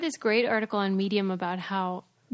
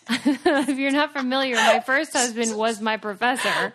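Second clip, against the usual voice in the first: laughing confession; 2 semitones higher, 5 dB louder than usual. Real, not acted. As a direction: confess it while cracking up. Amused confession about her own marriage.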